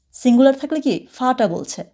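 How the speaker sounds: normal talking voice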